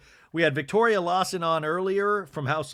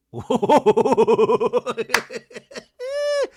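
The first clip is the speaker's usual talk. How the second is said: long, loud, high laugh; big laugh trailing into a high squeal; cackling laugh; 8 semitones higher, 6 dB louder than usual. Real, not acted. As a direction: burst out in a rattling cackle. Host laughs at Mary's joke.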